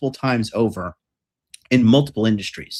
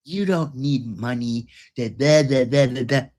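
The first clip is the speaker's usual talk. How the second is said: softer, sing-song mockery of their advice; mocking parrot; 4 dB quieter than usual. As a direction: dismissively parrot them, trailing into da da. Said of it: "mocking their advice".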